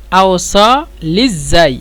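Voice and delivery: আওসাল ইজাই drawn out very slowly; very slow model pronunciation; slow demo. Shown as a demonstration. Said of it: "এভাবে বলবেন".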